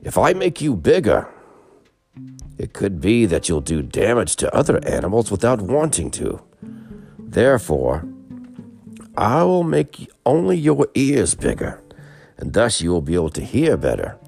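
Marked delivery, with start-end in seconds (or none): 0.0-11.8 louder, higher proclamation. Acted. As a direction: declare it loudly as a god. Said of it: "in a loud voice he says".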